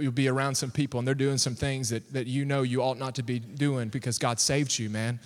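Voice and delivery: normal talking voice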